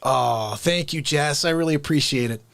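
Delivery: ordinary talk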